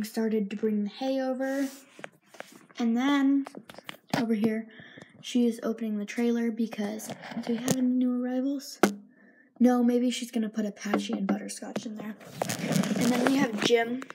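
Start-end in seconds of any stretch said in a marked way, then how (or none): none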